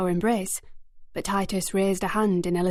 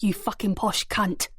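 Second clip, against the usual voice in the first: usual speaking voice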